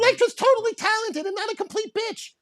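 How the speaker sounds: very high squeaky puppet voice; high lying voice. Shown as a demonstration. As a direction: demonstrate an obvious lie in falsetto. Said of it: "My, my voice gets high".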